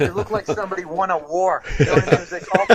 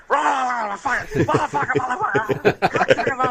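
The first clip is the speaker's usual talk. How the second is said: mock phone rant; 7 semitones higher than usual. Caller rages down the phone at brother, mock yelling. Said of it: "all I just hear is this guy like".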